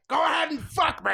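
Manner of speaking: hammed-up high yell; mock yelling. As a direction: imitate a man yelling wildly. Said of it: "Who's basically just yelling".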